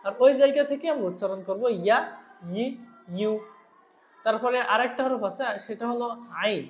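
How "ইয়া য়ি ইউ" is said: "ইয়া, ই, ইউ" each begun with a y glide; careful y sound on "ইয়া, ই, ইউ"; articulated from mid-tongue. Shown as a demonstration. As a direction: raise the middle of the tongue for "ইয়া, ই, ইউ". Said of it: "জিহ্বার মধ্যখান থেকে".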